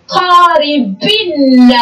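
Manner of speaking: قاربن read very slowly, the final نّ of قاربن drawn out. Shown as a demonstration. Teacher to pupils, slowly sounding out قاربن with a long final nun. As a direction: stretch قاربن syllable by syllable, holding the nun sound at the end. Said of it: "لفظنا النون الساكنة".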